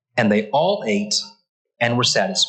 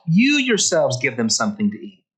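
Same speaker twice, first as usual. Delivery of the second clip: "You yourselves" punched at the start, the rest falling; emphatic YOU YOURSELVES. Retold or imitated. Preacher repeats Jesus' retort to the disciples, emphatic "You yourselves". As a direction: hit "You yourselves" firmly, then let it drop. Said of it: "this is emphatic".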